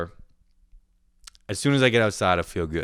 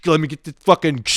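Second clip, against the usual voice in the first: louder, higher, wrestling with a rack; acted noisy struggle; 8 semitones higher, 8 dB louder than usual. Shopper fighting the spray paint rack, loud acted struggle. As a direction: punch it out between imitated clatters. Noisily rattling the cage, acted out.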